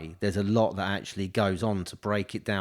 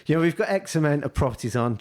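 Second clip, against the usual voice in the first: exaggerated posh, higher and fast; 5 semitones higher, faster than usual. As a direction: push the posh boast further. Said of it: "trying to extend it up a little bit".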